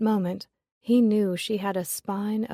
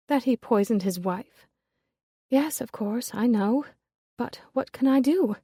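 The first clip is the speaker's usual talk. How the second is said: put-on higher young woman rising in despair; a raised, desperate lady; Lillian, helpless; 4 semitones higher than usual. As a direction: ask it with hands thrown up.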